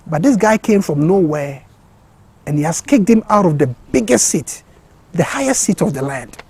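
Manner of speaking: normal talking voice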